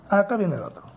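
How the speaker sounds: normal talking voice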